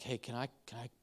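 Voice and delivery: softly whispered "can I call you"; whispered plea before surgery; hushed friend. Retold or imitated. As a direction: ask quietly from a hospital bed. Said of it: "And he's like".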